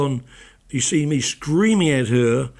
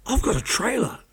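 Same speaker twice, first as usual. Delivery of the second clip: higher-pitched, mock-thrilled announcement; retold excitement; 9 semitones higher than usual. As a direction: play her giddy news, lightly imitated. Retold text from thrilled new star.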